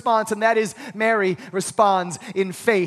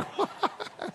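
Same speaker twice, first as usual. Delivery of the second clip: breathy laugh; 8 semitones higher, 12 dB quieter than usual. Real, not acted. Laughing at red faces.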